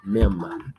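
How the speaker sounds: Mem isolated and stressed; stressed syllable alone. Shown as a demonstration. Teacher isolating the stressed syllable, Mem. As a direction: clip off Mem as the strong beat. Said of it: "So memories".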